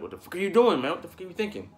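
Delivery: loud, high angry yell at the player; furious fan shouting at the screen; pissed-off yell. Retold or imitated. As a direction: yell at the cornerback in disbelief. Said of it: "I'm pissed off at him".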